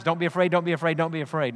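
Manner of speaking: repeated mantra. Retold or imitated. Preacher mocking anxious self-talk, fast soft chant. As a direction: rattle it off to yourself like a nervous chant. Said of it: "Don't tell yourself ten times".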